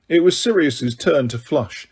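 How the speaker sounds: plain reading voice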